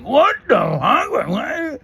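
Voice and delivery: very high, loud, drawn-out disbelief; panicked, garbled squeal of shock; hammed-up freak-out. Passenger hears plane crashed in dream, mock panicked shriek. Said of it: "I'm just fucking freaking out".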